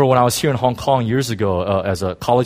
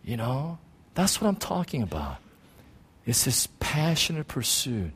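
soft earnest; 8 dB quieter, slower than usual. Real, not acted. Soft earnest close of point.

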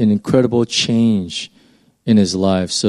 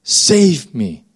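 pleading cry; 6 semitones higher, much slower than usual. Retold or imitated. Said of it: "He says".

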